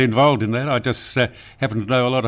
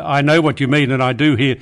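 normal talking voice

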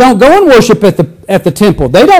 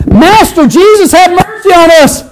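hollering; 7 semitones higher than usual. Acted. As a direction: holler across to Jesus. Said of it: "they hollered".

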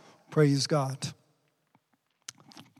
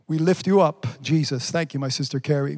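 ordinary talk, nothing marked

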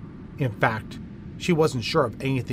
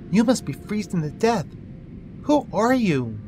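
worried; 8 semitones higher than usual. Acted. Karen finds freezing child.

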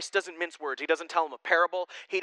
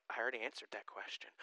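weary reply; 7 semitones lower, 17 dB quieter, faster than usual. Retold or imitated. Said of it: "he's like".

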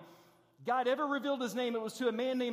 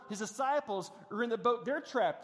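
ordinary talk, nothing marked

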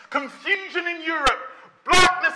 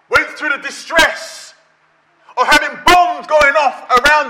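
loud, driving sermon voice; booming pulpit delivery; preaching at full force; 9 dB louder than usual. Acted. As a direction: preach it hard to the congregation.

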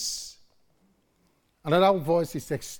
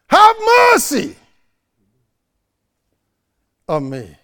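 much higher and louder, pleading cry; desperate shouted plea; 12 semitones higher, 9 dB louder than usual. Retold or imitated. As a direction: shout each plea up over the crowd. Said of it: "He shouted".

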